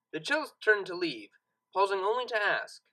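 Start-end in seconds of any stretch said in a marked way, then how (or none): none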